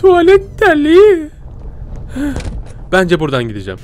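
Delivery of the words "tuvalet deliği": terrified scream. Real, not acted. Gamer hit by a jump scare, drawn-out scream. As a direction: shriek it at the scare.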